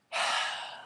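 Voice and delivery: a long, unvoiced tired sigh; weary sigh. Obatala sighing at Eshu's second request, resigned. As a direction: breathe out the weariness.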